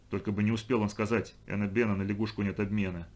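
ordinary delivery

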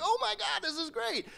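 high, breathy-soft delight; delighted hushed gasp. Retold or imitated. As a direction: marvel quietly at your luck. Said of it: "I'm like".

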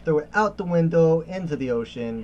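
loud dramatic. Acted. Tossing annoying compass away.

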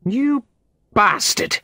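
high, loud furious scream. A narrator's reading. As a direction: scream the insult at him. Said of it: "Molly screamed".

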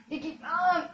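loud, high shouted call of the stunt; hyped catchphrase shout. Real, not acted. Gamer shouting his stunt name to viewers, loud and high. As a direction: yell the catchphrase with glee.

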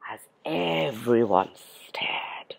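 slow, low whisper; hushed narration. A narrator's reading. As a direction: draw it out in a stunned hush. Hushed story moment, everyone staring.

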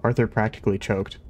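plain reading voice

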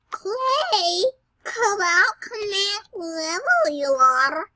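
high, coaxing sing-song call; horrible singsong voice. Pretend. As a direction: croon it like calling a hiding pet. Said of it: "that's a horrible voice".

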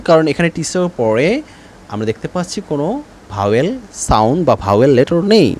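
usual speaking voice

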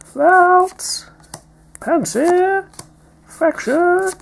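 silly high voice. Pretend. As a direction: announce each card in a squeaky cartoon voice. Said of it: "Don't know why I'm talking like that".

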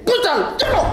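high, punched-out single words; blustering boast. Acted. Man claims to be brutal and violent, fierce bluster. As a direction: declare it fiercely, pitch up.

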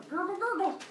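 high gurgling drinking sound; playful high gurgle; gulping noise. Acted. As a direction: imitate glugging down a cup of wine. Imitates gulping from tilted cup.

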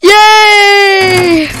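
loud high cheer; triumphant cheer. Real, not acted. Triumphant shout.

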